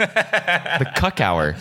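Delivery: slow drawn-out laughing show intro; announcer voice. Pretend.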